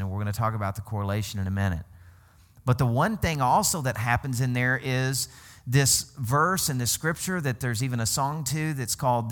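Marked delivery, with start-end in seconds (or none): none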